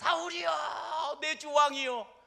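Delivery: loud, high call across the distance; preacher voicing David's loud cry. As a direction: shout it after the departing king. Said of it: "큰 소리로 소리를 지르면서".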